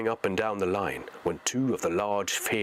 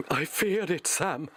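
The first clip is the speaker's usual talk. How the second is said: lightly voiced hobbit, higher and pleading; a frightened hobbit sees they're caught; Frodo in despair; 7 semitones higher than usual. Frodo tells Sam his luck failed, higher despairing voice.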